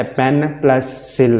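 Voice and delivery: "पेन" and "सिल" as separate syllables joined by "plus". Shown as a demonstration. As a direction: slowly break the word into "पेन" plus "सिल". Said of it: "हमारी sound रुक गई है".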